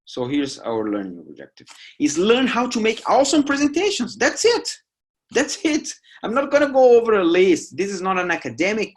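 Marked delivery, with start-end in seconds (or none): 2.0-8.9 much higher, louder, playful burst; gleeful punchline, voice jumping up high; excited. Acted. Teacher joking to students about the list, high excited burst.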